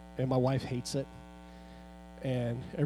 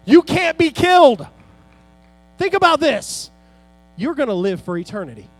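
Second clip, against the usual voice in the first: loud, high, driving sermon climax; full-force preaching; 9 semitones higher, 11 dB louder than usual. Acted. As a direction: preach it out to the whole congregation. Preacher peaks again.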